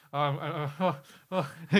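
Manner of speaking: choked up, moved to tears; getting emotional over the song; welling up. Real, not acted. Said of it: "Ken's crying".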